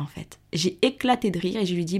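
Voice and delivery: usual speaking voice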